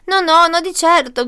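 high, loud frightened protest; terrified cry. A narrator's reading. Wolf refuses the witch's spell, terrified cry. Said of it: "gridò Lupo terrorizzato".

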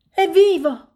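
loud, high cry of relief; joyful shout. A narrator's reading. Piglet finds his fallen friend alive, shouting. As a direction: shout the good news up to the others. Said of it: "gridò".

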